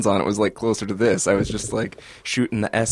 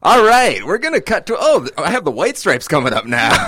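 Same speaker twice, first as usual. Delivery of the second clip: high, loud radio host announce; 6 semitones higher, 6 dB louder than usual. Shown as a demonstration. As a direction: slip into on-air DJ mode. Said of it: "Oh, you do have a radio voice".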